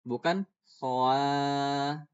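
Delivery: "khoa" dragged very slowly into two vowels, o then a; the incorrect khoa; wrong split vowel. Shown as a demonstration. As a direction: drag out "khoa" as the mistake. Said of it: "Nggak gitu".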